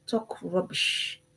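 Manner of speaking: slow, whispered scorn; scornful whisper. Real, not acted. Speaker mocking handsome men's empty talk, whispered slowly. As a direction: breathe the words out in disdain. Scornful aside about beautiful men.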